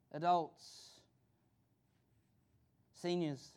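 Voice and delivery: ordinary delivery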